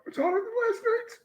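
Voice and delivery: high, strained squeak from the heat; real pepper burn. Real, not acted. Taster reacting to devil's tongue pepper, strained. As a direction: squeeze it out through the burn.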